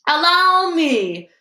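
high, slow, loud eager cry; shouted. A narrator's reading. Lockhart eagerly shouts his offer to fight the snake, high and slow. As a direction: leap in to show off. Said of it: "shouted Lockhart".

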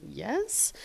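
tiny, hushed acted reply; hesitant whisper. Driver answering a talking car, hesitant whisper. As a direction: whisper it warily.

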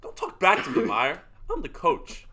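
arguing with oneself. Retold or imitated. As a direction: snap back as the coach. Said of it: "arguing with oneself as player and coach".

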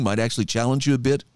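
usual speaking voice

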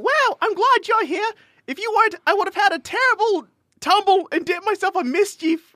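high-pitched British best friend; hammed-up, high mock British accent. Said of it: "give in to the, uh, like, Britishisms".

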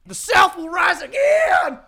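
high, loud, slow mock drawl shout. Mocking racist singer's slogan.

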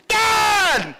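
very high, loud shout; kid's yell. Retold or imitated. Kids retrying their hard-of-hearing father, yelling. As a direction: bellow for Dad like a kid. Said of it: "sometimes they'll go".

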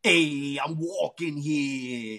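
lightly voiced New York tough guy, slow; a mild New York street accent, drawn out; New York impression. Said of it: "that's my New York impression".

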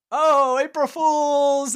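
high, loud, drawn-out prank reveal; mock gotcha. Greeter covering a wrong name with a child, hammed-up gotcha. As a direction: sing out a fake gotcha to cover the slip.

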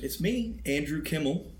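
high, eager lift on the name; excited. Real, not acted. Cohost announcing his own name to listeners, excited. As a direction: introduce yourself with keen excitement. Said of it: "sounded so excited".

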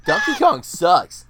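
slow, loud jeering retort; loud mocking quote. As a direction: drawl it out like a schoolyard taunt. Quoting Billy Madison reply.

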